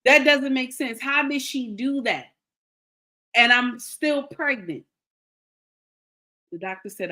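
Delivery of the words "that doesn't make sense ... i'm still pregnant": indignant demand with emphatic bursts; retold outrage. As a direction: replay your disbelief, pausing between outbursts. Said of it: "I was going crazy".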